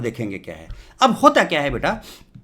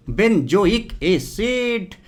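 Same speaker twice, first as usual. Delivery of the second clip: very slow, each syllable stretched; teacher drawing out the name; drawn-out answer; 3 semitones higher, much slower than usual. Shown as a demonstration. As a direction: spell the name out slowly for the class. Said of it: "क्या बोलते हैं इसको?".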